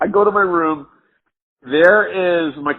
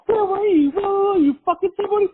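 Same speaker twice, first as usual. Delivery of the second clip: put-on much higher, shrill-pitched accusing girlfriend parody; mock jealous girlfriend. Imitating the ex demanding where he was, much higher. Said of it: "And I'm like".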